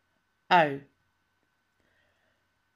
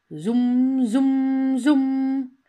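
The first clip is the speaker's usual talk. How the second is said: bee buzz; 3 semitones higher, 5 dB louder than usual. Retold or imitated. Imitating buzzing bee.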